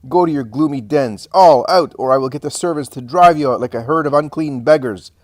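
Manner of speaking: loud, high, furious dismissal; wrathful shouts, peaking on the single-word order; imposing wrath. A narrator's reading. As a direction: drive them out with explosive commands. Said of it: "She is imposing in her wrath".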